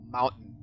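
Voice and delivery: "mountain" without a pronounced T; American-style "mountain" with the T dropped; American pronunciation. Shown as a demonstration. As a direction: swallow the T in "mountain". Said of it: "you don't pronounce the T".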